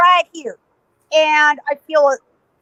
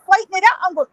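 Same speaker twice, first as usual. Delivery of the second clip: normal talking voice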